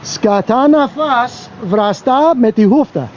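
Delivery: "Σκατά να φας, βραστά με τη χούφτα" spelled out very slowly, word by word; slow, careful Greek. Shown as a demonstration. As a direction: lay out "Σκατά να φας" slowly for the viewers to copy. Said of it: "And my accent's on point".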